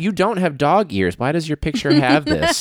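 puzzled clerk peering at a photo; mock ID photo clerk. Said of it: "Sir," like".

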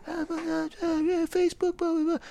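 high breathy mocking voice; mocking co-host. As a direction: mimic him high and soft. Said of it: "and then you go".

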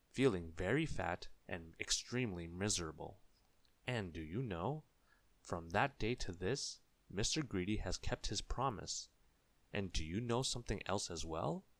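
plain reading voice

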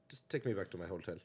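low, soft, slightly German director; a weak attempt at a German accent, muted; faint German accent. German director to the actor pitching him, faint German accent. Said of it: "That's your German accent?".

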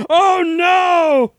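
sudden high shout; startled. Real, not acted. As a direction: jump at the scare. Reacting to scare.

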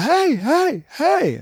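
high-pitched mock hailing calls; imitated yelling. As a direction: call out as if flagging down a car. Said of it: "they started yelling".

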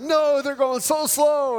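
high, slow whiny complaint; husband whining to his wife about slow drivers; whiny impatience. Retold or imitated. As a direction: whine like a kid stuck in traffic. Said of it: "I'm like".

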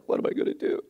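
mock tearful, whispery little girl; imitating crying child. Hurt little girl in the mud fretting to herself, mock tearful. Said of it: "she's crying because she's hurt herself".

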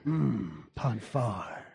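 low, soft seductive purr; a hint of bedroom voice; sultry murmur. Mock seductive replies.

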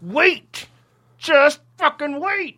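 high, loud, drawn-out yell; exasperated shout. Acted. Exasperated plea to filmmaker.

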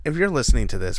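ordinary delivery

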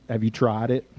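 dropped low and soft, almost to yourself; soft question. Shown as a demonstration. Preacher softly asking the congregation about sleep. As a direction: ask it quietly, gently probing. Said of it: "but have you".

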